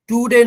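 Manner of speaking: "टुडे" with a long "too"; wrong long vowel. Shown as a demonstration. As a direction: stretch "टुडे" into "too-day". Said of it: "टुडे नहीं". ("टुडे" में लंबा "टू")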